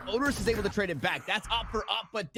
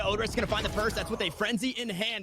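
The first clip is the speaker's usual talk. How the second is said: ordinary delivery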